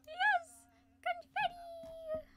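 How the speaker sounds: high, loud cheer; excited cheer. Acted. Celebrating the winner with a high cheer.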